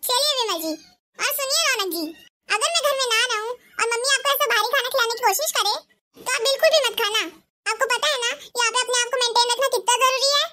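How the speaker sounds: a bossy bahu telling off her mother-in-law; shrill daughter-in-law. Acted. Ekta instructs husband. (सास को डाँटती तेज़ बहू, ऊँची आवाज़ में)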